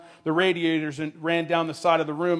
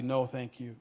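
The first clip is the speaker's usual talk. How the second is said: softly spoken, understated sadness; subdued, sorrowful refusal; very sad; 2 semitones lower, 7 dB quieter than usual. A narrator's reading. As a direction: decline politely with a quiet sadness. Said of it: "Linda said very sadly".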